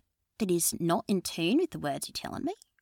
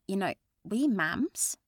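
put-on Australian accent; 2 semitones higher, 3 dB louder than usual. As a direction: say it like an Aussie mum. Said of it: "slip into that accent".

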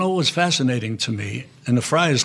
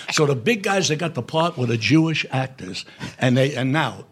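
ordinary delivery

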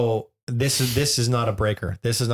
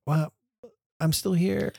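mock hurt parent; 7 semitones higher, slower than usual. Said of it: "and you're like".

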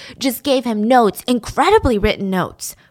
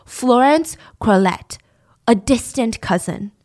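ordinary talk

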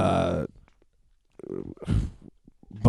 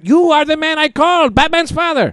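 high shout; 13 semitones higher, 5 dB louder than usual. Acted. Improv scene, shouting at repairman.

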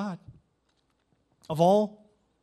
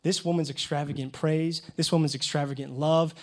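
ordinary delivery

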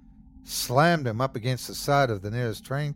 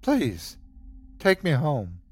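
begging; 3 semitones higher, slower than usual. A narrator's reading. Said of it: "I begged again".

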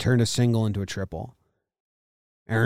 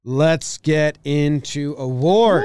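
announcer; 3 semitones higher, 6 dB louder, much slower than usual. Acted. Announcing segment, slow.

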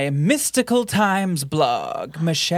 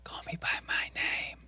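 echoing ghostly whisper; 13 dB quieter than usual. Retold or imitated. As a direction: whisper it back, unvoiced. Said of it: "It's like a whisper".

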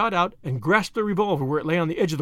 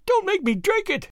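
shriek; 14 semitones higher than usual. A narrator's reading. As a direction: let the plea rise into a thin shriek. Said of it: "Her voice rose to a shriek".

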